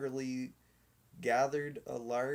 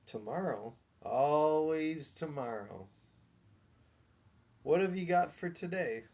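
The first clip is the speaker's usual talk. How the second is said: scoffing showman; 4 semitones higher than usual. A narrator's reading.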